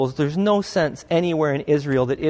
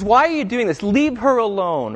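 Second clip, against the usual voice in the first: firm, raised scold, slowing on leave her alone; scolding back; 5 semitones higher, 3 dB louder than usual. Retold or imitated. Jesus scolding her critics, firm raised rebuke. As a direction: defend her with a stern rebuke. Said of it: "He scolds them back".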